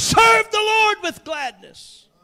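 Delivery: full-voiced preacher's shout; preaching. Pastor calling out to the congregation, preaching loud.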